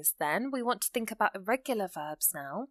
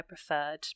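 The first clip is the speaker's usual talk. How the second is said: "preferred" lower, leaning on its last syllable; stress on second syllable; 5 semitones lower than usual. Shown as a demonstration. Teacher repeating the example for learners, second syllable of "preferred" stressed. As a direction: let the weight land on the end of "preferred". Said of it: "the second syllable, if you like".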